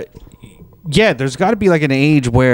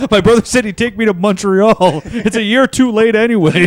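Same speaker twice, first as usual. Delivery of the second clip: higher-pitched pretend tearful complaint; mock whining; 6 semitones higher than usual. Playing the crying son with a birthday grievance, mock whine. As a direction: whine it with laughter breaking in. Joins in as crying nineteen-year-old.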